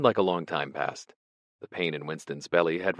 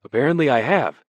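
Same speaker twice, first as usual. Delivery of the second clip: high, loud, fluttery scholar's voice; a vague, startled prisoner greeting an old colleague; nervous poet; 9 semitones higher, 8 dB louder than usual. Acted. Ampleforth greets Smith in the cell, high and startled.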